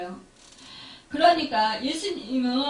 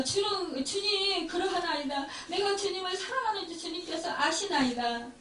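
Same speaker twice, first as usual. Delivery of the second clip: higher, softer earnest plea; pleading Peter; 5 semitones higher, 4 dB quieter than usual. Acted. Peter pleads with Jesus a second time, higher and softer. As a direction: plead your love gently, voice lifted. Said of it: "하시니".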